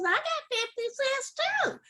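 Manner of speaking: high, excited squeal of a cat. Acted. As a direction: answer way up high, thrilled. Cat answers Dog, delighted.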